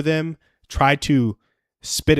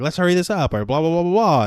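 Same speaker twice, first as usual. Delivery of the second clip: higher-pitched mock whining urge; impatient pleading; 6 semitones higher than usual. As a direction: imitate pushy, run-on pestering. Retold impatient pushing to marry.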